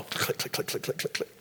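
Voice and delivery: whispered mock camera clicks. Mocking people snapping photos, whispered clicks. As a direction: breathe the clicks like a shutter.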